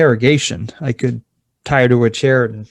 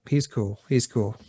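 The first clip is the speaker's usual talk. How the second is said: low, soft aside; hushed, casual vouching, pitched down; discreet reassurance; 3 semitones lower, 9 dB quieter than usual. Acted. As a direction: vouch for him under your breath. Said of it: "I give her the nod".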